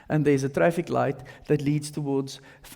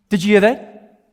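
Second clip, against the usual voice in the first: louder, raised question; insistent question; 3 semitones higher, 6 dB louder than usual. Acted. Birder asks companion who missed the bird, insistent question. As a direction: press your companion for an answer.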